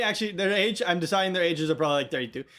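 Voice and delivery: ordinary talk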